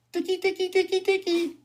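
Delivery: very high, soft squeaky chant; squeaky high calling. Real, not acted. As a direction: call a pet in a tiny squeak.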